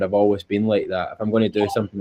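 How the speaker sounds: ordinary delivery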